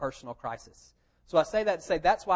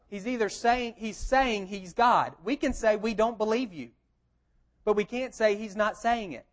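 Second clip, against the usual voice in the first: preaching; 5 semitones higher than usual. Real, not acted. Preaching climax.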